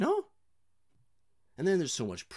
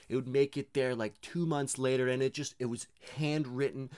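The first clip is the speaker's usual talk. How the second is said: normal talking voice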